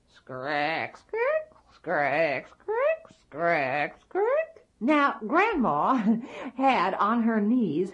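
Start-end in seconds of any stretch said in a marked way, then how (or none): 0.1-4.6 put-on see-saw squeak of an old rocking chair; rocking chair creak. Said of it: "it sounded like this".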